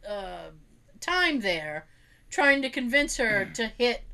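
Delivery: ordinary talk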